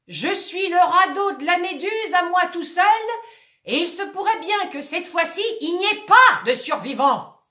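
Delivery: high, loud grand proclamation; shouting. Acted. Actor shouting his grand disaster to the audience. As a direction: declare doom with pride. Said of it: "il crie".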